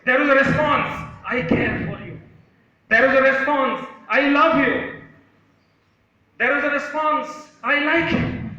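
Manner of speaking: high, loud ringing proclamation; shouted echo. Acted. Storyteller gives the mountain's responses to the boy, shouting. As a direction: shout each answer back from the mountains.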